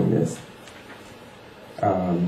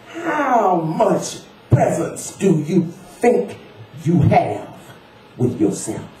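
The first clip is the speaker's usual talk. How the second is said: slow, high, word-by-word challenge; pointed question, each word set apart; jabbing each word; 12 semitones higher, much slower than usual. Acted. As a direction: jab every word like a finger into a chest. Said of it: "He spoke each word as though jabbing a stiff forefinger".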